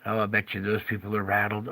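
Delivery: usual speaking voice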